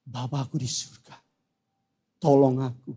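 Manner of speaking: low, soft prayer for help; hushed plea to God. Acted. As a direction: plead quietly, as if wounded. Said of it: "dan kau berteriak memanggil namanya".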